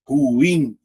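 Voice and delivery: ruim drawn out as ru-IM; stressed second syllable. Shown as a demonstration. Teacher pointing out the tonic syllable, ruim said as ru-IM. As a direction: lean on the im of "ruim", two separate syllables. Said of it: "A sílaba tônica aqui".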